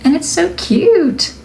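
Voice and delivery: high, slow, delighted gush. Real, not acted. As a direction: coo over something adorable. Gushing over her finished piece.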